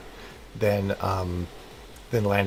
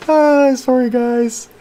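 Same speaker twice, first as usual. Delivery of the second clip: much higher and louder, still giddy from laughing; giddy apology; 9 dB louder, much slower than usual. Real, not acted. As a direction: blurt an apology while wound up. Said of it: "I'm crazy".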